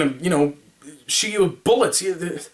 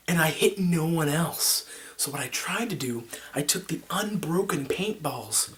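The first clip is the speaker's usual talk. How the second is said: ordinary delivery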